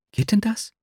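suddenly alert. A narrator's reading. Nastjenka suddenly alert at the narrator's offer. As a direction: ask with sudden hope. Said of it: "fragte sie plötzlich aufhorchend".

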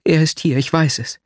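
high, urgent certainty; agitated insistence. A narrator's reading. Nastjenka insists her lover is back, agitated. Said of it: "fiel mir Nastjenka erregt ins Wort".

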